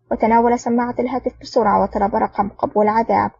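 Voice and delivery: plain reading voice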